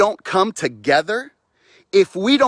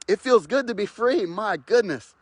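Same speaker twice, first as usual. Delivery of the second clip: normal talking voice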